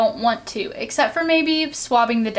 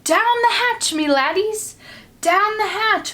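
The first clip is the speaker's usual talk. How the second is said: much higher hearty toast; pirate yell; 9 semitones higher than usual. Acted. Pirate captain toasting his crew at dinner, yelling. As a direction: roar it over the table. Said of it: "pounded his fist on the table and yelled".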